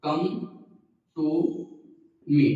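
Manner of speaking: words spaced apart with pauses; slowly, word by word; teacher repeating for the class; slow dictation. Shown as a demonstration. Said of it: "क्या बोलना है?".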